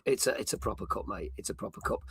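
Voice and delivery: whispered "It's a proper cup, mate"; hushed admiration. Real, not acted. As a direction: whisper it in awe. Admiring the mug aside.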